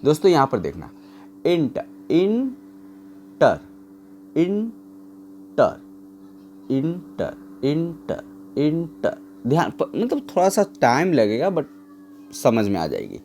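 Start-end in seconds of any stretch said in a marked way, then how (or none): 1.5-9.2 "inter" pulled apart slowly as इं and टर, with gaps; two unstressed syllables. Shown as a demonstration. Teacher letting students feel unstressed syllables, inter split slowly. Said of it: "दो unstress syllable है".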